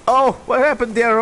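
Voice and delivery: ordinary talk, nothing marked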